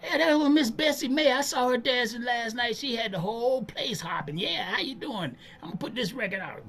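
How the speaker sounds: high, chatty on-air voice; radio DJ patter. Retold or imitated. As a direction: greet passers-by like a lively DJ. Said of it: "he would see people walking".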